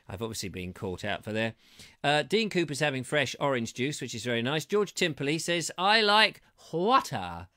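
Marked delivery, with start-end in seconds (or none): 5.8-7.5 slow, lightly posh reading of I like water. Retold or imitated. Host reading a viewer's comment, lightly posh and slow. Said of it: "It's very posh".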